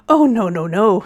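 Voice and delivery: loud, mildly shocked denial. A narrator's reading. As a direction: hurry to deny it, a touch scandalised. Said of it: "said Uncle Andrew in a shocked voice".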